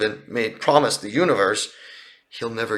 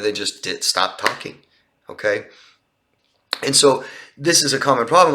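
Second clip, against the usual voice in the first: ordinary talk, nothing marked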